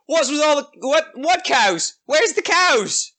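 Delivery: baffled ogre, pitched right up; confused ogre. Acted. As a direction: look about in dim bewilderment. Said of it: "He looks around confused".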